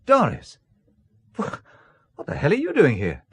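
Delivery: high, loud burst of a name, then a stammer; startled surprise. Acted. Man finding Doris at his hotel room, startled and surprised. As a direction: caught off guard by her sudden arrival. Woman unexpectedly at his door.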